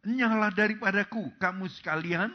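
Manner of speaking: a judge's loud dismissal; stern command. Acted. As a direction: cast them away with force.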